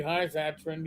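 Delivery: usual speaking voice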